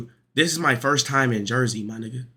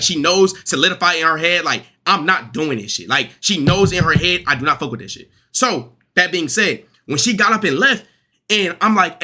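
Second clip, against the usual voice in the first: ordinary talk, nothing marked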